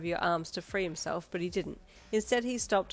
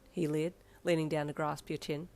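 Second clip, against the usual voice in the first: usual speaking voice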